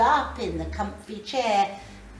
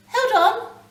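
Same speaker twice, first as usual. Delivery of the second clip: urgent call; 13 semitones higher, 9 dB louder than usual. Acted. Ghost urging before time travel.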